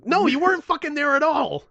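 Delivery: high, loud denial; hammed-up outraged correction; exasperated yelling. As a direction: shout down his tall tale. Acted quarrel, exasperated friend.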